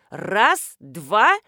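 loud count-off, punched word by word; counting shout. A narrator's reading. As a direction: call out the count loudly. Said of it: "закричала жена Дегтярёва".

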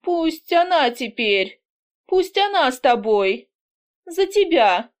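put-on a drunk mother breaking down; drunk and tearful. A narrator's reading. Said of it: "Она пьяна".

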